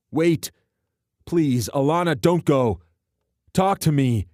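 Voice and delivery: high, loud pleading shout; shouted plea after her; desperate calling out. A narrator's reading. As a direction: beg her to stay. Trenton calls after her.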